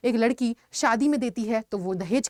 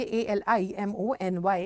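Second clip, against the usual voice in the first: spelling out letters; 2 semitones lower, slower than usual. Shown as a demonstration. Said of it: "तो हम इसे क्या बोलेंगे?".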